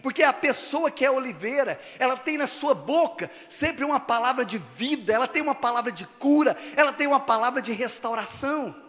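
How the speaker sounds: high, loud preacher's cry to the church; full-force preaching. Acted. Pastor calling the congregation to hope, loud preaching. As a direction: preach it out, lifting every phrase.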